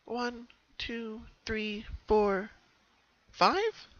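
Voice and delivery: high voice counting, the last number jumping up alarmed; count, then catch on the missing one; counting out loud. Acted. Pinkie counting her family in the picture, rising alarm. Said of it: "she counted out loud".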